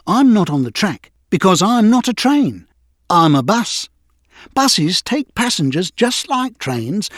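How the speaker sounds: a lightly voiced friendly bus. A narrator's reading. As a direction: introduce yourself kindly as Bertie the bus. Bertie voiced in story.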